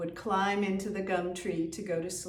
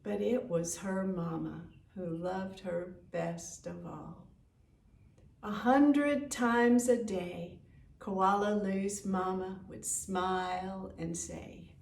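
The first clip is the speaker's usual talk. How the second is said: plain reading voice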